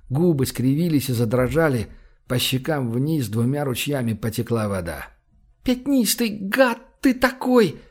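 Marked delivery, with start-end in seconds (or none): 5.6-7.8 high, slow, tearful cry; sobbing reproach. A narrator's reading. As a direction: choke it out through tears. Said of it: "захлёбываясь слезами". (сквозь рыдания, высоко и медленно)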